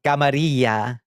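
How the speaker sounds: rolled Spanish-style Camarilla; Spanish pronunciation. Shown as a demonstration. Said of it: "When I hear somebody say Camarilla".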